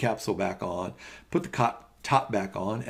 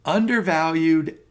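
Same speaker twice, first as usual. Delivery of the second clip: undervalued drawn out, higher and louder; stress on undervalued; 7 semitones higher, 7 dB louder than usual. Real, not acted. Lecturer stressing undervalued to students, slow and loud. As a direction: lean hard on undervalued. Lecturer emphasizing key word.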